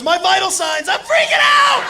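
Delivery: high, loud panicked shout; hammed-up freak-out, high and loud; panicked brother. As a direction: yelp it in mock panic. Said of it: "He goes".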